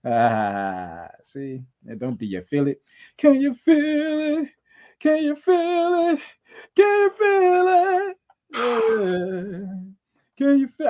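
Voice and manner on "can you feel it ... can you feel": very high, slow falsetto; falsetto taunt. Pretend.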